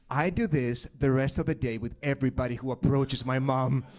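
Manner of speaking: normal talking voice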